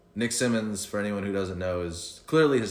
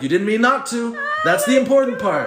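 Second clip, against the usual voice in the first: laughing shout; 12 semitones higher, 9 dB louder than usual. Retold or imitated. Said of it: "I'm like".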